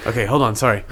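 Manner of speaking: ordinary talk, nothing marked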